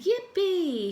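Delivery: high, joyful yell of yippee. Acted. Farmer yells to his cat and dog, overjoyed. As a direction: shout for joy in the rain. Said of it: "he yelled to Rooster".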